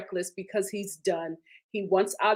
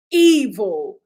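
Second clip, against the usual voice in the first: emphatic evil; 9 dB louder than usual. Real, not acted. Repeat verdict.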